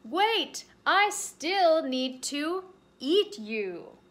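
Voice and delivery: loud, slow drawn-out call; bear calling out. Acted. Bear calling after the fleeing rabbit, loud and slow. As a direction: call the rabbit back, stretching it.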